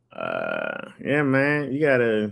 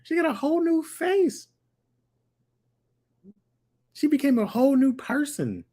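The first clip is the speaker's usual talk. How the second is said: high, fired-up rant about Khloe's new face; excited rant. Real, not acted. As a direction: get worked up and pitch it way up.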